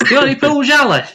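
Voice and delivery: high, quick Yorkshire question; mock Yorkshire accent. Asking a mate for a Pujala, mock Yorkshire accent. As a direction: ask in put-on Yorkshire, pitched right up. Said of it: "The Yorkshire pronunciation of Pujala".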